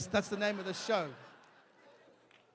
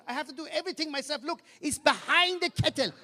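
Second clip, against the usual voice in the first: higher, louder exasperated scolding; scolding mother; 6 semitones higher, 4 dB louder than usual. Retold or imitated. Mother scolding her son at the kettle, higher and louder. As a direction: nag him like a fed-up mother. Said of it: "She goes".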